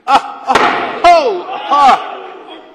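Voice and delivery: loud, high startled cries of ah and oh; startled yelps. Real, not acted. Senator startled as a colleague crosses the debate line, shocked cries. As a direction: jolt back in shock with sharp cries.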